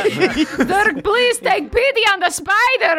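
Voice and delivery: high, hammed-up plea for mercy; playful pleading. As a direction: beg in mock alarm, amused. Joins the acted plea to Zerk.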